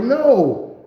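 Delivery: high, slow, pleading no; scared denial. Retold or imitated. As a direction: stretch the denial up high, protesting.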